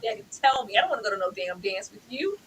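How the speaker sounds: ordinary talk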